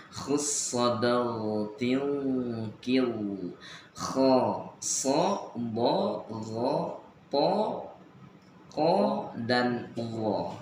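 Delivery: "kho, so, bo, ro, po, ko, wo" with full, rounded o; thick heavy letters. Shown as a demonstration. Teacher lists heavy letters for student, each pronounced thick with an o sound. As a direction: slowly draw out "khussadal tilkil", then each letter thick. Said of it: "tebal di situ ya".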